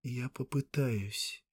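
faraway half-asleep voice, slow; distant dreamy voice. A narrator's reading. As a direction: say it slowly, as if in a dream. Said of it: "странно далёким голосом, словно в полусне".

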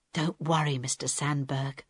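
low, hushed reassurance; whisper. A narrator's reading. Harold reassures Mister Sandberg while they are held captive, whispering. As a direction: murmur it low so nobody overhears. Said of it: "Harold whispered".

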